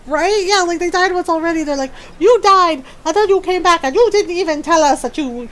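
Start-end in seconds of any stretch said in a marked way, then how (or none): 2.2-5.5 loud, high accusing start that eases off; hammed-up offended accusation; mock outrage. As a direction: act out indignant friends scolding. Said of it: "mad at Shepard".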